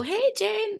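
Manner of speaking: high, breezy hello to a friend; informal, high-pitched hey; casual greeting. Shown as a demonstration. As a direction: greet a friend you know is calling. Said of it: "If it's Jane, I'll go".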